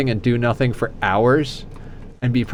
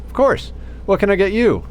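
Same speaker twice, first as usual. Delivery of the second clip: much higher, bright sing-song waitress; chirpy waitress; 9 semitones higher than usual. Retold or imitated. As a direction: play up the overly cheerful server. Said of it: "Waitress comes over".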